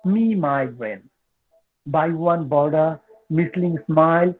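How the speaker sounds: slow, measured poetry reading with long pauses; poem recitation. Acted. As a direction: recite each short phrase deliberately, letting it hang. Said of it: "I'm trying to reciting my poems".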